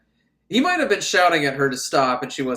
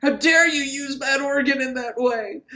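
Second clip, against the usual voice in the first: high-pitched scandalized cry; mock priestly outrage; 10 semitones higher, 3 dB louder than usual. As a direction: scold in a high, hammed-up voice. Priest's outrage, first take.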